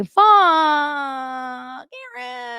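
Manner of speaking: copying Kayla swearing, in slow motion; mock Kayla curse. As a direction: stretch it out dramatically. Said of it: "hear her say".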